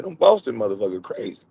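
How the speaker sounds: ordinary delivery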